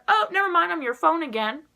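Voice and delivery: voicing the phone. Phone acting as if nothing happened.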